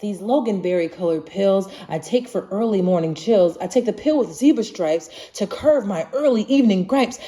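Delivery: plain reading voice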